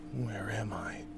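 very soft, breath-only question; hushed, bewildered whisper; frightened whisper. Acted. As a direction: whisper it, lost and fearful. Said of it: "I whispered".

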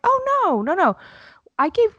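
high, loud oh no no no; a startled teacher rushing to deny; squealed mock protest; shocked denial.

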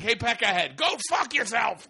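put-on high-pitched New York-Boston street accent; mock Boston accent. East Coasters trading insults, mock Boston accent. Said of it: "Or like New York and Boston".

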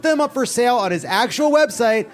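upbeat infomercial pitch; ad read. Acted. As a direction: sell it like a cheesy commercial.

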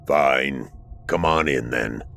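low grudging creature voice. Acted. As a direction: lage onwillige stem. Lighthouse creature grudgingly admits Arthur.

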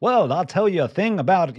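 put-on high, fast 1930s mid-Atlantic accent; old film star mid-Atlantic accent. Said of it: "I love that mid-Atlantic accent".

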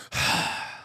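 imitated heavy, breathy sigh; exasperated sigh. Pizza worker after slamming the pan, imitated exasperated sigh. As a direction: let out a big defeated huff. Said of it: "And her head dropped, and she goes".

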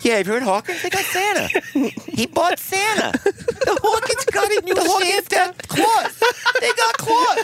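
a nosy New York neighbor spreading news; New York accent. Retold or imitated. Neighbor gossiping about the Hawkins' Santa in a New York accent. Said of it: "neighbors that sound like that".

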